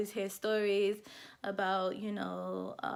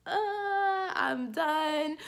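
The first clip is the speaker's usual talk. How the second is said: higher, louder "I'm done"; fed up; 8 semitones higher, 4 dB louder, much slower than usual. Real, not acted. Giving up on wedding planning, fed up and higher. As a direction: throw up your hands.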